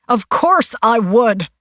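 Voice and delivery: loud, slow, high angry shout; father shouting his denial at the detective, loud and slow. A narrator's reading. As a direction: shout it back at the detective, offended. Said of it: "Foster shouted".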